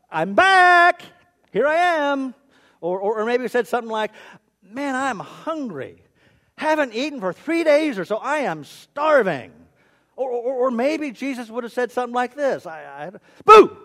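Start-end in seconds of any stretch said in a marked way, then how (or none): 0.1-2.3 high, loud, hammed-up comeback cry; triumphant announcement. Preacher imagines risen Jesus announcing himself, triumphant shout. 4.6-9.5 comic complaint. Pretend. Preacher imagines risen Jesus starving after three days, comic complaint. 13.5-13.8 sudden high, very loud shout; playful scare. Pretend. Preacher pretends risen Jesus startles the women, playful scare.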